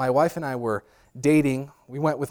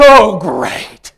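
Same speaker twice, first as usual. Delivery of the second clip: louder, exasperated sarcastic groan; sarcastic dad; 4 semitones higher, 12 dB louder than usual. Retold or imitated. Father fed up at the roadside, sarcastic. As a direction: let the disgust drip. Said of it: "as only my dad could do".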